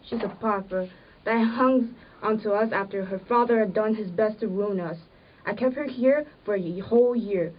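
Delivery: the wife lashes out at the poor relative; bitter resentment. Acted. Wife's bitter quarrel over Maddie.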